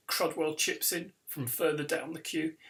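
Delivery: usual speaking voice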